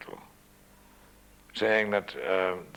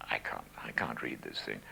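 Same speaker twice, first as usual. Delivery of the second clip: hushed, voiceless worried murmur to himself; whispered thought; 5 semitones lower, 6 dB quieter than usual. Retold or imitated. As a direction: whisper the panic under your breath. Panicked inner thought before broadcast.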